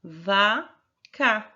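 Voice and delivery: "vaca" split into two loud beats, va... ca; deliberate teaching demo of "vaca"; slow syllable-by-syllable. Shown as a demonstration. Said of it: "vamos falar a palavra vaca pausadamente".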